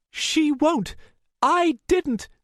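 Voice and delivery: high, loud protest; desperate high-pitched outburst; frantic denial. A narrator's reading. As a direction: blurt out panicked denials. Frantic denial to her superior.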